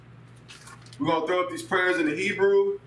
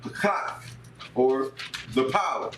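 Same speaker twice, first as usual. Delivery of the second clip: chanted Hebrew call lines, raised and falling; prayer leading; 4 semitones higher than usual. Real, not acted. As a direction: intone each phrase for the group to repeat. Leads second Hebrew prayer.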